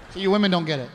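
ordinary talk

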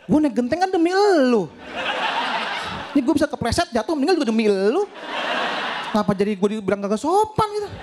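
high, laughing indignant protest; indignant outrage. Real, not acted. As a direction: protest how unfair she is. (protes kesal dengan suara tinggi sambil tertawa)

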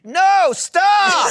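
high, loud shout of alarm; hammed-up panicked yell; retold scream. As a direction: scream it out as a desperate warning. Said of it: "I screamed".

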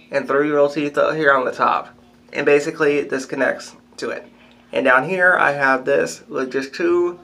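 lips pulled back by fingers; talking with dentures in; fingers in mouth. Real, not acted. Seen 0: Fingers pull lips back to show partial dentures while speaking.

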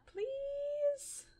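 very high, small begging please; drawn-out high plea; pleading. Pretend. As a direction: beg sweetly in a high voice. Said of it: "we have to plead for forgiveness".

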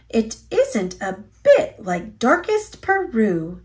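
usual speaking voice

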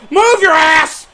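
high, loud yell; urgent shout. Real, not acted. Gamer yelling at his runner, urgent shout. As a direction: holler at the runner to hurry.

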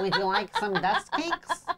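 high, slow squeaky gnome voice, laughing; random gnome voice. Retold or imitated. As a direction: put on a silly high gnome voice. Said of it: "You do your random gnome voice".

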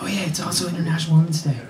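quick, soft throwaway mention; offhand mock aside. Host mimicking a colleague's casual aside. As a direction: toss it off quietly as an afterthought.

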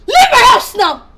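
loud, slow, furious command; mother ordering the teacher out, loud and furious; angry order. Acted. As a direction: throw her out of the house.

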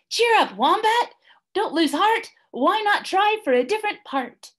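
very high, bright encouragement; cheery kangaroos. A narrator's reading. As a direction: buck him up.

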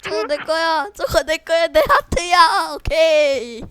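high, loud and triumphant; excited shout. Real, not acted. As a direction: yell it like you won. Claiming item excitedly.

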